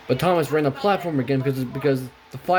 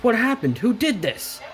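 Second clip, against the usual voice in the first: higher-pitched shocked exclamation; mock scream; 8 semitones higher than usual. A narrator's reading. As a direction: cry out in alarm, without real volume. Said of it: "screamed Thomas".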